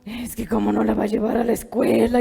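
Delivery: angry complaint. Acted. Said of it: "Así bien enojada".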